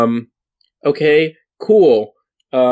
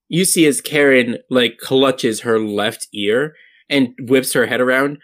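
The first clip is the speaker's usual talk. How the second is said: ordinary delivery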